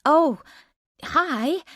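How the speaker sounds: put-on like a kid who's been caught; caught off guard. Caught-out narrator on phone.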